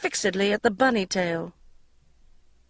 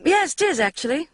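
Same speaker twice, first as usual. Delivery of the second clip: defiant; 10 semitones higher than usual. A narrator's reading. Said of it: "jutting my chin out".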